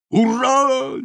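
high, drawn-out cheer; joyful shout. A narrator's reading. Two friends cheer once the sorcerer vanishes, joyful shout. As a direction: cry it out as a hurrah. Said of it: "закричали Бомштекс і Бошбермак".

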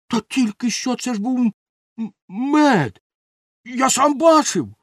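high, stammering protest; flustered self-justifying. A narrator's reading. Helper defends himself to the angry baker, flustered excuses. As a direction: protest your innocence, tripping on the words. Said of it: "виправдовувався Бошбермак".

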